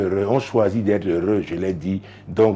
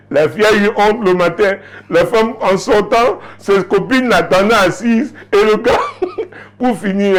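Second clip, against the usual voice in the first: much higher, louder, bubbling with laughter; excited laughing retelling; 11 semitones higher, 6 dB louder than usual. Real, not acted. Preacher to congregation, excited and laughing. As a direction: tell the juicy part half laughing.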